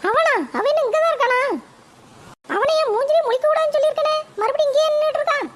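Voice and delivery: high-pitched mother fuming about her son; shrill-pitched scolding mum, fed up; annoyed mother. Acted. Mother complains to grandma about her son, high and irritated.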